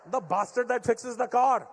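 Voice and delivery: put-on Indian-accented dad losing patience; exasperated Indian dad. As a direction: scoff it out like a fed-up father. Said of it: "make everything sound Indian".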